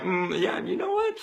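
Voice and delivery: soft, hesitant second thought; quiet, tentative reconsidering; pondering. Retold or imitated. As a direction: mull it over under your voice. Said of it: "thinking about it a bit more".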